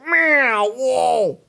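high, drawn-out swooping "meow, whoa"; imitated plummeting graph. Host mimics a plane's flight graph, high swooping noise. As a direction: sound out a dive with a high wail. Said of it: "it was like".

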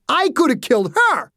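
high, exasperated yell; outraged echo. A narrator's reading. Driver to the girl's father, outraged. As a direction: hurl the accusation back, incredulous. Driver throws accusation back.

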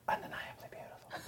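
breathy cologne-ad whisper. Shown as a demonstration. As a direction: whisper it like a perfume ad. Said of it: "Would it be a whisper?".

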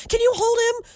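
lightly mimicked high-pitched asking; imitated request. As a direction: ask it high, imitating the other person. Imitated request to take a child.

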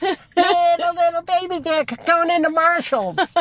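squeaky sneer at a driver in the lot. Real, not acted. Mocking another driver.

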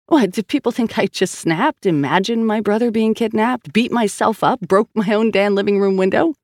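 incredulous woman defending her sanity; indignant. Acted. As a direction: throw the accusation back, pitch held high. Sal indignantly defends herself.

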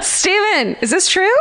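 high, half-yelled accusing question; mock yell. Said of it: "I almost yelled at Steven".

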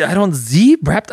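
slow mock German accent. Host joking about the Z button in a mock German accent. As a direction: play the confused German, slowly. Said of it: "went German".

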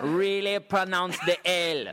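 slow and high, leaning on the L of "pronounce the L"; hard L. Shown as a demonstration. Comic coaching host, "L" said hard and long. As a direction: stretch the final "L" hard in mock Jamaican. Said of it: "say the L really hard".

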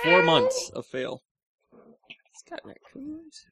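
high, slow mock lament. Mock lament over failed hunt.

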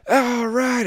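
high whoop of relief; cheering. Retold or imitated. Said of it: "I start clapping".

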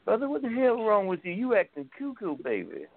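high put-on wrestler voice dropping at the end; Dusty Rhodes. Dusty looking down at kneeling man, Dusty Rhodes impression. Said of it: "Dusty's looking down on him like this".

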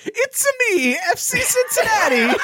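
very high mock Italian accent; Mario. Co-host mocking Drew's accent, high hammed-up Italian. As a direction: squeal it in cartoon Italian, breaking into laughter. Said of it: "Mario accent".

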